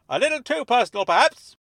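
high, loud interjection; flustered Dennis. Acted. Dennis objecting to Tarquin's prying question, loud and flustered. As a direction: object loudly on her behalf.

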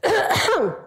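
deliberate "ahem" cough; throat-clearing cough. Real, not acted. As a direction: clear your throat deliberately before going on. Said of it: "let me cough".

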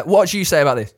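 ordinary talk

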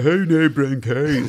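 slow, stretched D4 vowels, lightly done; posh South Dublin accent. Shown as a demonstration. Asked to demo the D4 accent, slow South Dublin drawl. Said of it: "Give us a blast of it".

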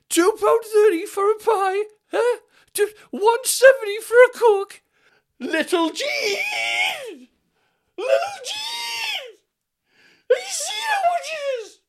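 put-on very high, loud, drawn-out outraged old woman; shrieking old woman. Old woman shouting to her friend Jean about prices, high and loud. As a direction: wail like a scandalised granny calling her friend.

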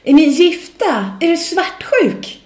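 put-on high, loud, slow drunken yell; drunk woman shouting. Drunk woman yells at the singer, slow and loud. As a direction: yell it like a drunk guest at a gig. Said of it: "började hon skrika på mig".

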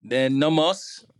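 a quick slow burst of Jamaican patois; Jamaican patois accent. Shown as a demonstration. Guest answering host's question in Jamaican patois, slow and drawn out. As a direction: drop into Jamaican patois on request. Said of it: "You have some Jamaican accent in there still?".

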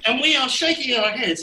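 usual speaking voice

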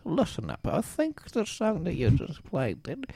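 hushed, wavering caller voice; Cecil the caller. Pretend. Caller Cecil guessing a forties tune for the hosts, hushed. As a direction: play a quiet, hesitant phone-in guest.

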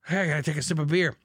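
ordinary delivery

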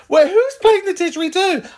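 high, loud put-on voice; mock outraged character. Heckler asking who makes that noise, high mock complaint.

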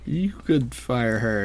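slow, drawn-out suggestion in a put-on voice; slow it down like a sly boss; lightly voiced Mr. Krabs. Mr. Krabs nudging SpongeBob to fire Pearl, slow and sly. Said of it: "Mister Krabs is like".

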